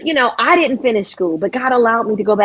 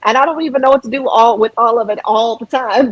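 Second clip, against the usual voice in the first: usual speaking voice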